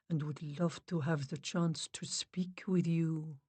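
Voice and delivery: slow, soft, fading to a whisper; hushed medium. Acted. Medium relays the spirits, slow and hushed. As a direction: speak gently as a spirit medium. Medium speaks to the dead softly.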